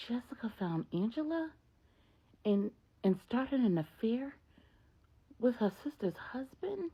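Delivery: high, rising, stunned question; can't believe what she's hearing, voice jumps up; shocked disbelief. Retold or imitated. Maria learns of the affair.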